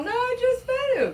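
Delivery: squeaky imitation of the snake guy; mock dude voice. Snake owner brushing off the warning, mock high voice.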